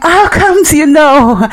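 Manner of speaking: high, slow, loud astonished question; the maintenance man amazed his cover is blown; caught-out surprise. Retold or imitated. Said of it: "And he was like".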